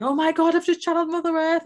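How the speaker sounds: retold giddy excitement to her partner on the phone; excited squeal. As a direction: burst out with overjoyed amazement. Retelling her joyful call to partner.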